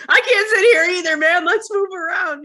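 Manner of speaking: high, loud and fast, restless; excited mock plea. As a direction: burst out like you can't sit still either. Mom quoting herself at dance breaks.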